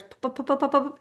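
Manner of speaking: rapid string of little pops; quick-fire popping sounds; popping firecrackers. Retold or imitated. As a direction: imitate a string of firecrackers going off. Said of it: "that string of firecrackers".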